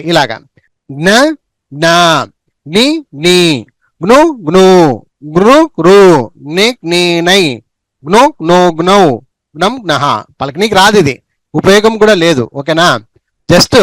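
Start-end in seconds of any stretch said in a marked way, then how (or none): 0.9-10.0 short vowel then stretched vowel, from జ్ఞ to జ్ఞహ; syllable chart recitation. Shown as a demonstration. Teacher showing how to practise on one paper, measured chanting. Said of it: "ఎలా practice చేయాలి?".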